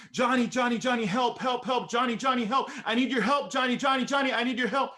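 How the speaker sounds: retold frantic screaming. Little sister screaming down the phone at dawn for her brother, panicked high cries. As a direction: scream his name like your mother's life depends on it. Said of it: "she's screaming, she's yelling".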